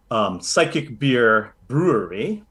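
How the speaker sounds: usual speaking voice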